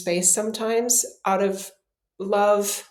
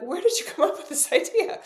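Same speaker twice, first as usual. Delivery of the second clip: amused disbelief; 7 semitones higher than usual. Retold or imitated. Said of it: "she kinda looked at me like".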